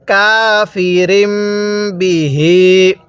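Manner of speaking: কাফিরিম বিহি slow, the মিম joined and hummed; slow tajweed recitation; Quranic recitation. Shown as a demonstration. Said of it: "তাহলে".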